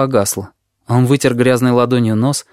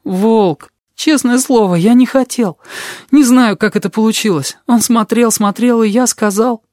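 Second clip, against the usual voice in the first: high, pleading, near tears; beg, fighting back tears; close to tears; 11 semitones higher, 3 dB louder than usual. A narrator's reading. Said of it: "Кузнечик чуть не расплакался".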